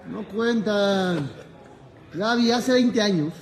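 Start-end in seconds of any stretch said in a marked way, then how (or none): 0.1-1.3 slow emphatic. Real, not acted. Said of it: "remata lentamente".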